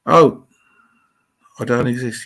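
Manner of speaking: ordinary talk, nothing marked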